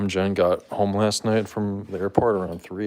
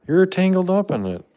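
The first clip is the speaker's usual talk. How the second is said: pet talk; 11 semitones higher, 6 dB louder than usual. Real, not acted. Talking to tangled cat.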